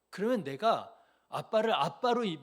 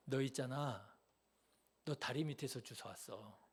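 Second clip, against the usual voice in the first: soft, hushed uncle's teasing; 5 semitones lower, 10 dB quieter than usual. Retold or imitated. Uncle teasing his little nephew, soft and hushed. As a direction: murmur it low like a sly uncle. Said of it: "그렇게 저를 골려 먹었었어요".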